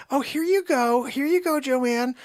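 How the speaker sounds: high singsong imitation; put-on staff voice. Staff give Joanne the shot, high put-on voice. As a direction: hand it over in a high, mock-sweet voice.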